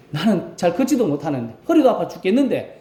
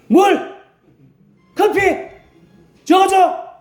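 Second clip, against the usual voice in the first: sharp, much higher, louder one-word commands; bossy orders; 14 semitones higher, 5 dB louder, slower than usual. Retold or imitated. Elder barking orders at younger deaconesses, loud and bossy. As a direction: fire off orders like a spoiled child. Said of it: "이렇게 이야기합니다".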